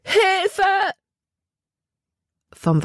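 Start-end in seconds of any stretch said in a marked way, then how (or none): 0.0-0.9 cry for help. A narrator's reading. Girl falling into the water screams for help. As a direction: scream for help as she falls. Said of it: "und schrie laut".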